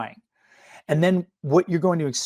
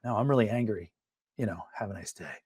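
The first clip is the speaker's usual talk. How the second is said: suppressed anger; 3 semitones lower, 8 dB quieter, faster than usual. Shown as a demonstration. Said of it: "Or they could say".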